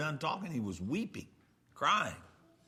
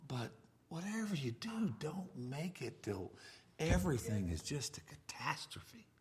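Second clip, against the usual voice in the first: dropped to a hushed whisper; whispered warning; 8 dB quieter than usual. Acted. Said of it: "drops to whisper".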